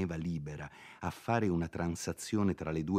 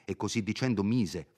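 ordinary delivery

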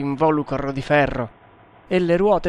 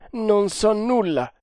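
sad tremor; 8 semitones higher than usual. A narrator's reading. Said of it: "una vibrazione triste".

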